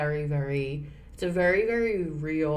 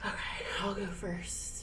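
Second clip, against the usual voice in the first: whisper; 8 dB quieter, slower than usual. Real, not acted. Offering to go first.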